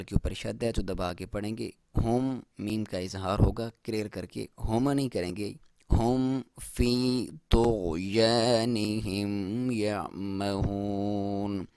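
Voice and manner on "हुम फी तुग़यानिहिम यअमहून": slow, drawn-out tajweed chant; slow Quran recitation. Shown as a demonstration. Teacher reciting the verse for students, slow and drawn out. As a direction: recite it slowly, stretching each vowel. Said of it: "तो को मोटा".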